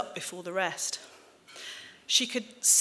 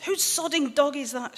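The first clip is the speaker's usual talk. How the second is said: higher-pitched, mildly exasperated outburst; mock-indignant mum; 7 semitones higher than usual. Mum demanding whose dog soiled her onions, mock indignant. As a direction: imitate an affronted demand, pitch lifted.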